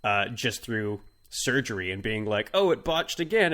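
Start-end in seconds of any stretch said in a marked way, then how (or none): 2.5-3.5 high, loud exclamation in character; mock dismay. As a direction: play a comically dismayed operating room. Acts the hawk's surgery botched.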